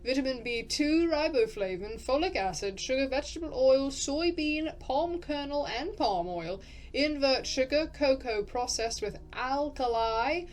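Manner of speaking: lilting English accent, item by item; vaguely English narrator accent. Shown as a demonstration. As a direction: read the list as a generic English narrator. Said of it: "I've kind of gotten it back".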